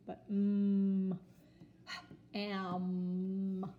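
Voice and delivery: loud held m in am; stressed m sound. Shown as a demonstration. Teacher contrasting m with n for kids, loud and held. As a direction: press on the m of am. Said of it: "The M, not the N".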